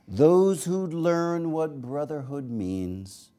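slow, high incantatory verse, softening at the close; poetry reading. Acted. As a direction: intone each line high, then let it fall. Reads a poem aloud.

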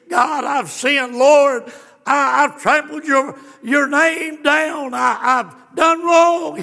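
slow, loud, high cry of repentance; anguished confession. Acted. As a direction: cry out your sin before God. Sinner weeping before the Lord.

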